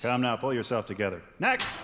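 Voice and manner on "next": calling out. Acted. Doctor calling next patient.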